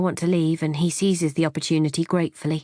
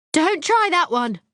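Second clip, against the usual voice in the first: much higher, louder, slow outburst; high-pitched explosion; 12 semitones higher, 4 dB louder than usual. A narrator's reading. Wife exploding at husband through the door, high-pitched. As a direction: explode at him, high and shrill with rage. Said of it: "I explode, all high-pitched".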